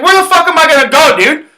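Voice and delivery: high, loud re-enacted protest; exasperated outburst. Kicked out in February, protesting to security, exasperated.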